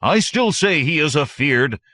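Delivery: plain reading voice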